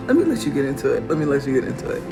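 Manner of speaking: ordinary talk, nothing marked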